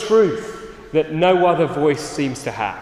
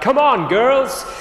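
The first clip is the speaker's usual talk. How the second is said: high, louder, drawn-out call; imitated shout; 10 semitones higher, 5 dB louder, slower than usual. Someone shouting to the farmer's sheep, imitated shout. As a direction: call out slowly, pitched well up. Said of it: "got someone else to shout".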